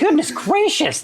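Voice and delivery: high, slow gasp of surprise; surprised exclamation. Real, not acted. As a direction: exclaim it, amazed and laughing. Host startled by guest's remark.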